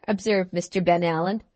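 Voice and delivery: usual speaking voice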